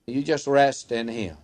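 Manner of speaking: usual speaking voice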